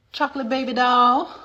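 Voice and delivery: ordinary talk, nothing marked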